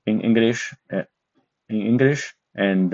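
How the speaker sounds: normal talking voice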